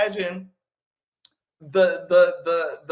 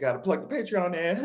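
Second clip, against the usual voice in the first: ordinary talk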